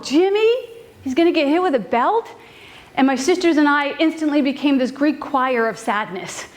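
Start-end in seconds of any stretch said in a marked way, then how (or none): 0.0-2.3 high, rising alarmed question; dismayed disbelief. Retold or imitated. Sisters hearing their brother will be punished, dismayed rising voice. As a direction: ask in shocked disbelief.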